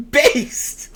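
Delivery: high, squeaky cheer; real glee; delighted exclamation. Real, not acted. Delighted reaction.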